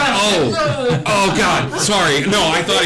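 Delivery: high, loud flustered apology; startled cat apology. Acted. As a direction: blurt it out, caught off guard. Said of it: "And the cat's like".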